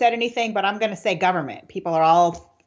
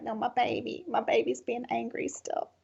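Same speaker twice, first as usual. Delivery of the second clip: tender; 4 semitones higher, 9 dB quieter than usual. Retold or imitated. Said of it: "I'm like".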